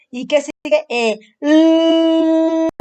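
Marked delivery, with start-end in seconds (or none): 1.4-2.7 long held "l", tongue raised to palate; sustained L sound. Shown as a demonstration. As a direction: hold llll on a higher steady note. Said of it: "sube la lengua".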